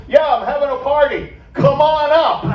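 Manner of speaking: hollering an open invitation to strangers; calling down to the street. Retold or imitated. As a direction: shout the invite to the crowd below. Murray invites crowd from porch.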